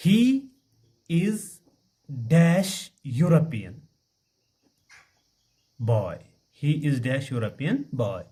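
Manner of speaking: slow, word by word, with long gaps; spelled out for students to copy down; slow dictation. Shown as a demonstration. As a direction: dictate it slowly to the class. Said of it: "मैं ऐसे लिखूंगा".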